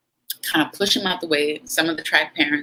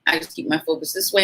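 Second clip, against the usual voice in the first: ordinary talk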